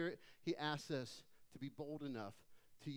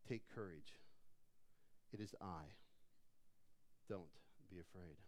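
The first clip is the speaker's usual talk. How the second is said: very low, soft voice with long pauses; quiet reassurance; 12 semitones lower, 13 dB quieter than usual. A narrator's reading. Jesus to terrified disciples on the lake, quiet reassurance.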